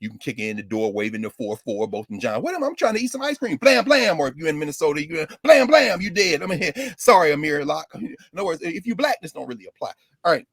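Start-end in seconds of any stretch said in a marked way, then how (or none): 3.6-4.2 mock gunshots. Mimicking police gunshots. 5.1-6.4 mock gunshots. Mimicking police shooting someone.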